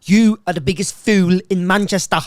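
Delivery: loud mock outrage; Andy Tate. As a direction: belt out the quote in his voice. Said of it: "What's his famous quote?".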